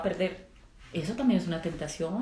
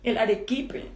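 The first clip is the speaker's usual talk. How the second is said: whiny protest; 5 semitones higher than usual. Retold or imitated. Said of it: "Yo decía".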